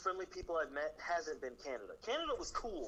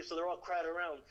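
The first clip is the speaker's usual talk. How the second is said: usual speaking voice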